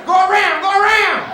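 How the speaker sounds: yelled command. Retold or imitated. Yelling at squirrel.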